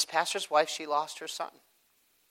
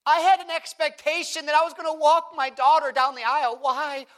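higher and louder preaching; full-force preaching; 11 semitones higher, 7 dB louder than usual. Acted. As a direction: preach it up to the congregation.

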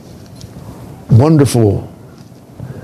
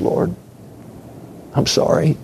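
much softer, half whispered; hushed apology; 10 dB quieter than usual. Acted. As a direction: murmur the apology almost silently. Psalmist apologizes to God.

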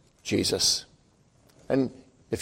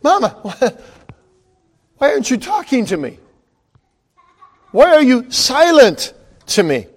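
put-on higher, pleading child's voice; a child begging mom to answer; hurt child pleading; 7 semitones higher than usual. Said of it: "You'd say".

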